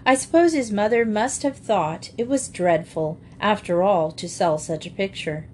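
ordinary delivery, nothing marked

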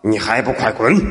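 gruff low command to the servant; low old man's scolding. A narrator's reading. Spirit orders the servant away, low scolding. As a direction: bark it low like an old master. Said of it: "骂道".

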